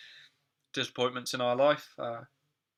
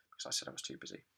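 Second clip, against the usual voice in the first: whispered; 11 semitones lower, 11 dB quieter, much faster than usual. Shown as a demonstration. Teacher reading example sentence.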